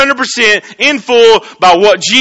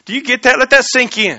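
usual speaking voice

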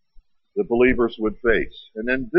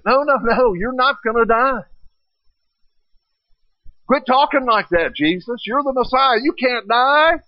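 voicing Peter protesting; 10 semitones higher than usual. Peter pleading with Jesus not to die, high and urgent. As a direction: insist he can't die, pitched up and pleading. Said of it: "Peter said".

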